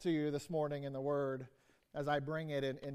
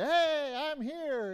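much higher, bright "Hey" called out; the overjoyed version; cheery greeting; 9 semitones higher, 4 dB louder, much slower than usual. Pretend. Preacher greets the congregation, mock thrilled hello. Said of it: "that whole storyline".

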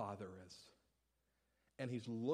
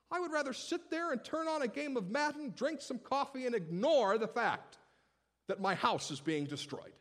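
ordinary talk, nothing marked

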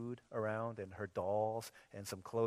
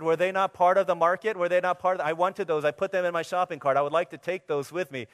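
loud, indignant customer complaint; mock outrage; 6 semitones higher, 12 dB louder than usual. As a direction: protest like a wronged shopper. Mock outrage at daughter's store.

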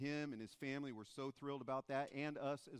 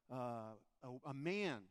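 ordinary talk

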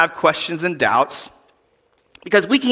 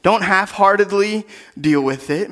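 ordinary delivery

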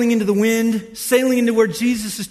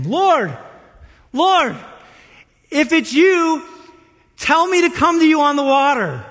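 raised, louder call across a distance; 6 semitones higher, 4 dB louder than usual. Acted. Peter calling to Jesus out on the water, calling out. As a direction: call out high and loud, phrase by phrase.

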